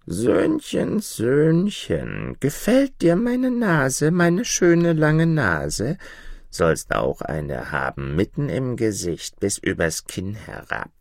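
slow, high creepy crone; hoarse old hag. Acted. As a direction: drawl it like a taunting witch. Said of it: "mit heiserer Stimme".

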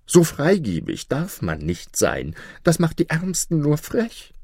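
high-pitched, complaining vizier; suspicious scheming vizier. Acted. Vizier warns king against his son-in-law, suspicious.

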